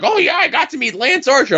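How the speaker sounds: a deliberately bad impersonation of Pat; bad Pat impression. Host mocks a friend's podcast remark in a bad impression. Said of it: "I'm doing a bad impersonation of Pat's voice".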